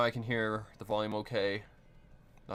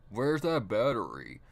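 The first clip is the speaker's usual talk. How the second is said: ordinary delivery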